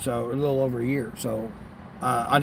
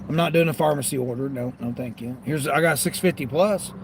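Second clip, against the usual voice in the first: ordinary talk, nothing marked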